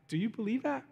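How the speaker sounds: very soft, probing question; gentle questioning. Retold or imitated. God to the praying man, hushed questioning. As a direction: ask it quietly, letting it rise at the end.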